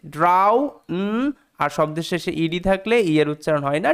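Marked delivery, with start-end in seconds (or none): 0.1-0.7 ড্রাউ ending on an open "ow"; AU sound. Shown as a demonstration. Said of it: "আউ sound দিচ্ছে".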